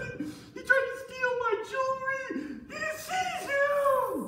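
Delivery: very high mock-tearful accusation; hammed-up wailing. Wife falsely accuses Joseph to guards, fake wailing. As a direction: wail each accusation in a squealing fake cry. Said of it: "He was a really good actor".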